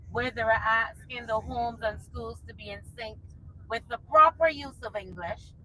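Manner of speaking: ordinary talk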